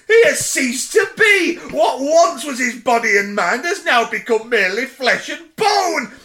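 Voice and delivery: high, slow, incredulous tirade; outraged rant. A narrator's reading. Girth ranting at the suspect about the corpse, outraged.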